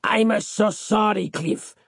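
embarrassed apology. A narrator's reading. Embarrassed waiter boss apologises.

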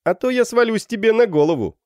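high, loud cheeky warning; shouted threat. A narrator's reading. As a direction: call the threat down from above. Student threatens from the pillar. (задиристая угроза криком)

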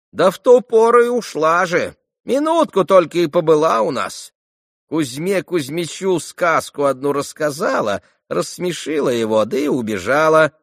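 high, loud crone's chatter; old servant woman. A narrator's reading. Old servant telling Mitya about the visit, high chatter.

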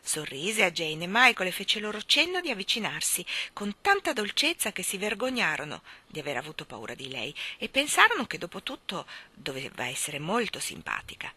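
ordinary delivery

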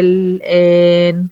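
الآن chanted slowly, the long alif held for six beats; tajweed recitation. Shown as a demonstration. Teacher showing Sahar the word, slow chanted recitation. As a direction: recite الآن drawing out the madd. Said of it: "دي التقسيمة بتاعت".

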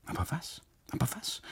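urgent breathy whisper, unvoiced; whispered panic. Husband panicking silently at the bitten custodian, whispered. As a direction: whisper it to yourself in alarm. Narrator's panicked inner thought.

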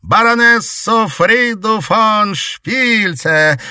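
high, slow, theatrical presenting; grand announcement. A narrator's reading. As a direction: draw out the name grandly. Witch formally presents baroness.

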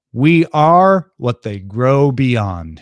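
drawn-out wise words; a lightly voiced wise master, slow; slow solemn quote. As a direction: say it slowly, like a sage. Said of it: "he says".

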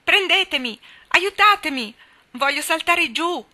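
very high, very loud panicked pleading; desperate cries for help. A narrator's reading. Jack hanging from the branch, begging the boys for help, loud and frantic. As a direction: scream for help as if you're about to fall. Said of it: "invocando disperatamente aiuto".

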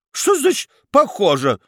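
an affronted baroness demanding an answer; indignant. Acted. Baroness bristles at the judge's court: high indignant cry. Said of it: "возмутилась баронесса".

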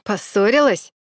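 lightly mocking question. A narrator's reading. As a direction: taunt a stranger with a touch of derision. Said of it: "раздался из темноты насмешливый голос". (насмешливо, поддразнивая)

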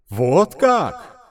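loud, high divine wrath; booming goddess voice. Acted. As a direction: thunder it down as an angry goddess. (голос разгневанной богини)

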